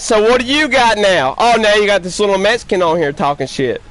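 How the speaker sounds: ordinary delivery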